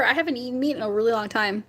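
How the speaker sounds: normal talking voice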